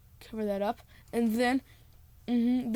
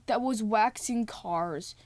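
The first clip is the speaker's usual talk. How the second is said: ordinary talk, nothing marked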